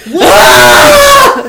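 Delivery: very high, loud yell of "what"; screamed incredulous reaction; shouted disbelief. Retold or imitated. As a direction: shriek it in exasperation. His shouted reaction to the moaning.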